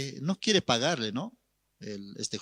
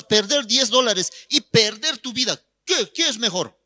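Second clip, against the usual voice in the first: high-pitched, forceful scolding; impassioned pastor berating a fool; merciless rebuke; 7 semitones higher, 3 dB louder than usual. Retold or imitated. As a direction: hammer the questions home. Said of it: "Le exhortó el pastor sin misericordia".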